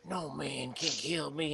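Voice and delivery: low, slow, hushed menace; deep quiet villain voice; Witch King. Retold or imitated. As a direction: growl it low and slow like a wraith. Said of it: "he's like".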